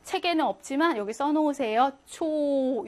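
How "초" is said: 초 held long; long vowel. Shown as a demonstration. Teacher shows how to say it long, 초 drawn out. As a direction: stretch the vowel of 초. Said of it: "이렇게 길게 발음하시면 됩니다".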